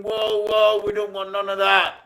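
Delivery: high-pitched mimicked backing down; panicked pleading. Crew pleading with the man pointing a gun, panicked. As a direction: imitate frightened men calming a gunman.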